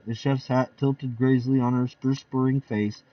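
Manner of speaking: ordinary delivery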